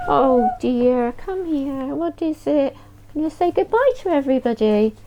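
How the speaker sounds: high, sing-song pet talk; coaxing the dog sweetly. Real, not acted. Talking to her real dog.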